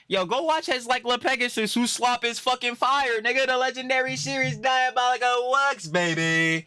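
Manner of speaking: very high, loud hype yell; hype-man shout. Pretend. Streamer plugging LaPegasus's series, high shouted hype. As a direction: belt it out like a promo hype man. Hyping another creator's series.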